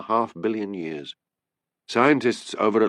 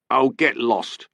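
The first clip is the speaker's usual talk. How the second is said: louder mock scream in an English accent; a hammed-up screaming wife; 4 semitones higher, 3 dB louder, slower than usual. A narrator's reading. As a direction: fling it at the husband. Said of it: "she screamed".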